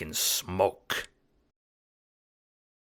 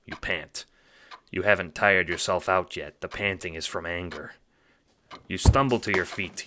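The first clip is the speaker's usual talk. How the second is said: ordinary delivery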